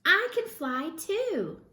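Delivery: high, slow and proud; bursting with new confidence; eager. Acted. Stella Luna boasts she can fly, high eager. Excited baby bat.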